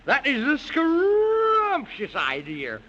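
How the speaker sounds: ordinary talk, nothing marked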